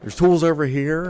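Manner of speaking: hammed-up innocent pointing out; fake discovery. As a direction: son faking alarm to the dad. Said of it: "I was like".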